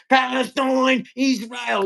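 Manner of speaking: high, drawn-out mock chant; mocking protesters. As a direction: whine it like a protester you can't stand. Mimics protesters he is sick of.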